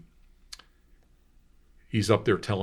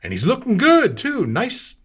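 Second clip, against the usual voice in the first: very high, loud proud exclaim; proud shopkeeper; 13 semitones higher, 8 dB louder than usual. Retold or imitated. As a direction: brag about the outfit. Shopkeeper praising clothes.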